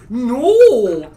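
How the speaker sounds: very high, very loud shrieked no with laughter; crazy shrieking no. Retold or imitated. Retelling his girlfriend's reply to a question, laughing shriek. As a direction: shriek it through laughing like she did. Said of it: "It sounded crazy".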